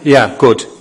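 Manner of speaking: ordinary talk, nothing marked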